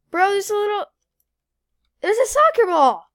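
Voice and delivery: surprised. Real, not acted. Finding an odd object.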